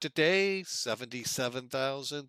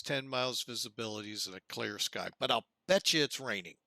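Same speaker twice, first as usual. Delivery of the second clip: ordinary talk, nothing marked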